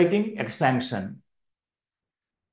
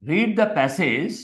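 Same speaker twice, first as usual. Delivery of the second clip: slow dictation; much slower than usual. Shown as a demonstration. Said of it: "first step ఏంటి".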